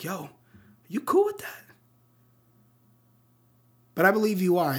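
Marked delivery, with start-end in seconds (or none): 0.0-1.6 Miles Morales. Retold or imitated. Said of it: "look at ourselves like Miles and just be like".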